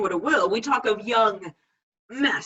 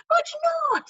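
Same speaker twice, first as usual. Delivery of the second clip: much higher pleading conscience voice. Retold or imitated. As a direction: warn him in a piping little voice. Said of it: "says my conscience".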